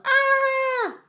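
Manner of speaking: high, loud scream of fright; terrified scream. A narrator's reading. Scared teenager screaming at Lance's face, loud and high. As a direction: shriek and run away. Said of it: "The teenager yelled".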